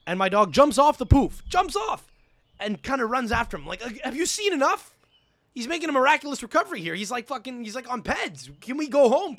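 high, excited incredulous retelling; amazed, exasperated. Retold or imitated. Amazed retelling of dog's recovery.

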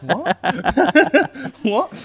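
baffled disbelief. Retold or imitated. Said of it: "I'm like".

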